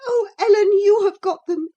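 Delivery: put-on high, loud tearful girl; crying young girl. A narrator's reading. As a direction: plead through tears. Girl begs nurse for letters.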